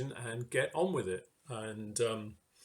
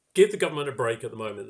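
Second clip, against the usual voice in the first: louder, faster, brushing the complaint aside; mock dismissive; 7 dB louder, much faster than usual. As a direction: rattle it off like an impatient critic. Voicing the opposing view mockingly.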